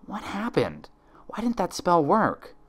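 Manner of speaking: high, bewildered questions; she's just woken from the backlash and can't understand; dazed Twilight. Acted. Twilight on the library floor, high and bewildered.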